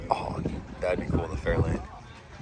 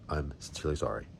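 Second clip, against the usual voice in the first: usual speaking voice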